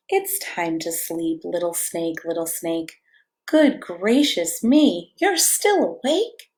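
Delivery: slow, gentle bedtime read-aloud; cozy storybook reading; reading to children. A narrator's reading. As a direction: lull a toddler toward sleep. Reads picture book to child.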